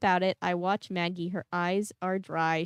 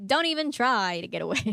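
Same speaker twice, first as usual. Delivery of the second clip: loud, pitched-up mock villain threat; Visser Three; 5 semitones higher, 5 dB louder than usual. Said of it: "did the Visser Three voice".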